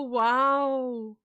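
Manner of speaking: usual speaking voice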